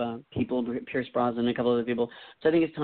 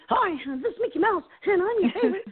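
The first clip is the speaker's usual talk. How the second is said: much higher squeaky cartoon mouse voice; Mickey Mouse. Shown as a demonstration. Guest performs his voice impression on request, high squeaky Mickey. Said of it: "Can you do your ... voice impression".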